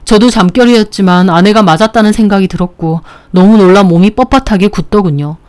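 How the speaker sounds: usual speaking voice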